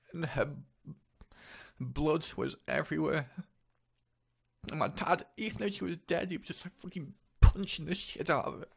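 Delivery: real distress breaking through the telling. Real, not acted. Recounting mother's death in dream.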